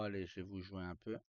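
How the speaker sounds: usual speaking voice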